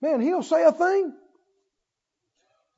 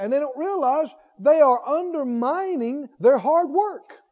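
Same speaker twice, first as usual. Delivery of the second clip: ordinary delivery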